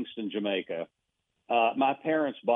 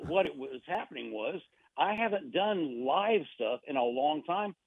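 ordinary talk, nothing marked

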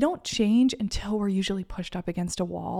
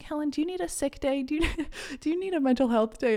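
gentle self-check; 7 semitones higher, faster than usual. Retold or imitated. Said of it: "I was like".

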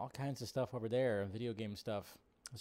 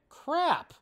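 exasperated; 6 dB louder than usual. Retold or imitated. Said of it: "and I went".

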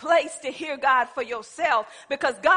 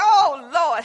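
frustrated plea, louder; retold frustration; 8 dB louder than usual. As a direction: cry out to the Lord in mock exasperation. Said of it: "So I'm getting frustrated".

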